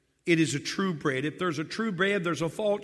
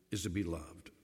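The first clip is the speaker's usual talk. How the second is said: soft emphasis; 9 semitones lower, 9 dB quieter than usual. Acted. Preacher telling husbands softly what wives need. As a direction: drop low and soft on to be loved. Pastor lands the first need.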